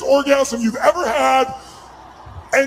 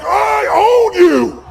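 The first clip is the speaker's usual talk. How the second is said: drawn-out, high shout of a challenge; challenging shout; 7 semitones higher, 7 dB louder, much slower than usual. Shown as a demonstration. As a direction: belt it out slowly and loud, claiming your opponent. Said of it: "I mean, say like".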